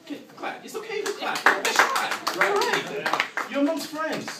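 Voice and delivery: high, pleading urging to clap; coaxing crowd. Real, not acted. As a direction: coax the audience warmly to clap. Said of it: "coaxing audience applause".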